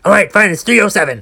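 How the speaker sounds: high, clipped, dismissive mock cashier; imitating a rude cashier brushing a customer off; curt cashier. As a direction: toss the total off like she's done with you. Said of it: "the mean drive-thru cashier ... yelled at me".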